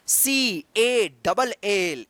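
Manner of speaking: very slow letter by letter सी ए डबल एल; stretched spelling, each letter apart. Shown as a demonstration. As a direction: spell it out slowly for the class. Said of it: "इसको क्या पढ़ेंगे".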